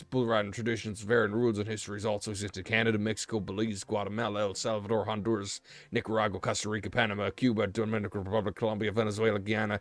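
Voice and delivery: reading aloud in a put-on American accent. Streamer reads an encyclopedia entry to viewers, American accent. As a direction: rattle the list off in an American accent. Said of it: "you're speaking in an American accent".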